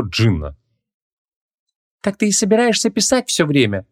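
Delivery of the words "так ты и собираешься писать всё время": high-pitched bored question; bored heroine in a raised voice. Acted. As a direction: ask with idle impatience. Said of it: "скучающе осведомилась она".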